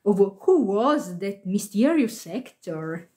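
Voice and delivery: ordinary delivery, nothing marked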